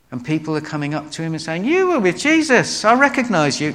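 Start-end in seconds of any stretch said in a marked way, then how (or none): 1.6-3.7 high, loud accusation; accusing. Retold or imitated. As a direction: point the finger, much higher and louder. Bystanders accuse Peter.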